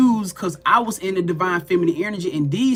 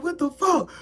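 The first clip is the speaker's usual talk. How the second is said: very high, panicked exclamation; retold freaking out; 10 semitones higher than usual. Back in her body and scared, retold freak-out. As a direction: act out a high-pitched freak-out. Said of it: "I was freaking out".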